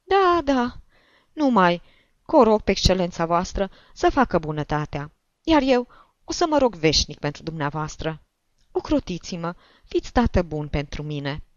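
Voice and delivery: put-on high-pitched old woman begging a favour; insistent, high-voiced petitioning; pleading old woman. A narrator's reading.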